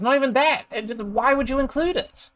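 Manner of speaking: high-pitched baffled protest; incredulous, voice jumping up high; exasperated disbelief. Real, not acted. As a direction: burst out in sheer exasperation. Exasperated outburst at the review.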